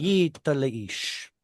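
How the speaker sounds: slow V'hayita leish; slow teaching read of V'hayita leish; careful reading. Shown as a demonstration. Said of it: "do we have a pause in between".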